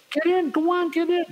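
high-pitched mock command; imitated valet hurrying a guest along; urging valet impression. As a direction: hustle someone into a car in a put-on high voice. Valet urges him into car.

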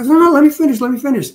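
insistent interruption. Retold or imitated. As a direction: cut him off firmly so you can finish. Cutting off the crying witness.

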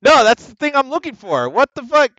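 loud, high, exasperated outburst at the game; frustrated yell. Real, not acted. Gamer yelling at a puzzle he can't solve, loud and high. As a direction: yell in disbelief at the screen.

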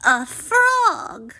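high, revolted princess shriek; high-pitched startled cry. A narrator's reading. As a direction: shriek it at the sight of something slimy. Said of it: "she cried".

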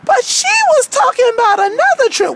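character storytelling voice; aggrieved Wendy. Acted. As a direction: recount it as a wronged cartoon girl. Wendy tells of the trip offer.

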